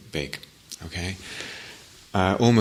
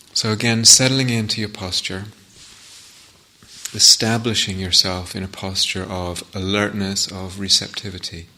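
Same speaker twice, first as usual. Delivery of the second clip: guided meditation. Acted. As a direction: guide listeners gently inward, phrase by phrase, leaving silences.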